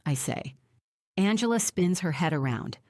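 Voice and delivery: usual speaking voice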